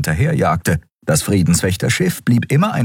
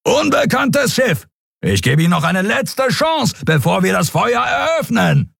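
shouted ultimatum; 14 semitones higher than usual. A narrator's reading. Captain shouts a final ultimatum before opening fire. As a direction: shout the last warning.

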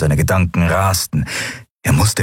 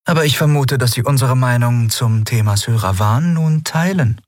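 a slightly higher, composed empress voice; calm, polite ruler holding back a secret; lightly voiced empress; 7 semitones higher than usual. A narrator's reading. Empress reassuring the admiral, lightly higher voice.

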